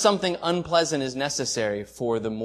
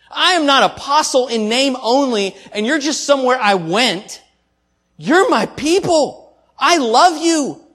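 impassioned plea; 6 semitones higher, 5 dB louder than usual. Acted. Preacher voicing Paul's heartfelt plea.

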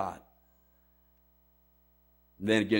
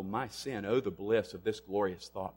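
hushed hymn recital; 3 semitones lower, 9 dB quieter than usual. Acted. Hymn quoted hushed in sermon.

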